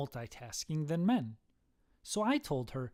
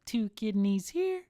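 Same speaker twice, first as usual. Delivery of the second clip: lightly voiced child, higher pitch; little kid; 6 semitones higher than usual. Son points to his belly, high kid voice. Kid's joke answer, first take.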